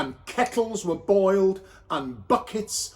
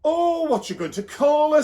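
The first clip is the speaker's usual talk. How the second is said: much higher hopeful question; eager Bev; 10 semitones higher, 5 dB louder than usual. Acted. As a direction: fish for her own name. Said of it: "said Bev".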